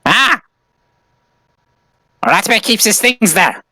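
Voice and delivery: high-pitched panicky character voice; squeaky in-character alarm; alarmed protest. Acted. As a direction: yelp and object as your things are invaded. Character protests light entering his backpack.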